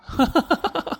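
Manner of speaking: high, gloating laugh; short villain's laugh; menacing laugh. Acted. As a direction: laugh tauntingly after a threat. Man threatens, then laughs.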